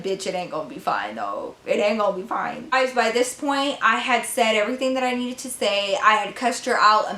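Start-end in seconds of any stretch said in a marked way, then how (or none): 0.0-2.7 lower, softer menacing warning, imitating herself. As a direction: mutter the threat low and cold. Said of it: "And I was like".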